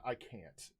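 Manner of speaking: normal talking voice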